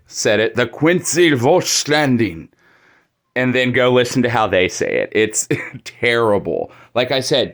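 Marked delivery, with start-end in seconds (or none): 0.1-2.5 Volkstanding said the German way, crisp and deliberate; careful German Volkstanding; German pronunciation. Shown as a demonstration. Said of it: "Listen to how I just said it".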